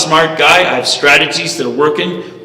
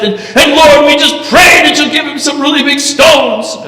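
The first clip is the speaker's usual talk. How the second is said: high, loud, fervent prayer shout; mock impassioned prayer, pitched way up; imitated loud praying; 14 semitones higher, 3 dB louder than usual. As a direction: belt out a hammed-up worked-up prayer. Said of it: "as she gets louder and louder".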